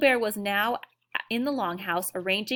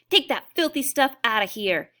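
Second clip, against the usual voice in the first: loud, higher Texas cowboy shout; 4 semitones higher, 6 dB louder than usual. A narrator's reading. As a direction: bellow it like an angry Texas cowboy. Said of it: "he suddenly shouted out in a strong Texas accent".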